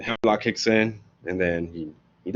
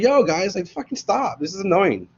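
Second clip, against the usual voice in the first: annoyed; 9 semitones higher than usual. Retold or imitated. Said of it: "Socrates is like".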